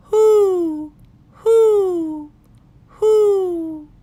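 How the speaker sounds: high, loud owl hoots from the trees; answering owl call. A narrator's reading. The owl answering Pa from the woods, high loud hoots. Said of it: "an echo came threading its way".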